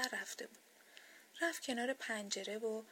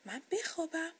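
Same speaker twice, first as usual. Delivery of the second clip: higher, astonished question; surprised; 6 semitones higher than usual. A narrator's reading. Doll who has never slept answers Sleep, surprised. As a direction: ask in rising surprise. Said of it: "با تعجب گفت".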